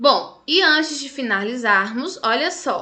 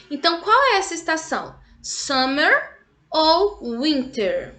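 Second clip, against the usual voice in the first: quiz question; 4 semitones higher than usual. Shown as a demonstration. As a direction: stretch out Fall ou spring like a quiz. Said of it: "teacher quiz question to kids".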